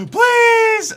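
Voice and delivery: high, loud gasping "Please"; desperate plea. Real, not acted. Streamer begging the game, desperate. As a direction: beg the game with a gasp.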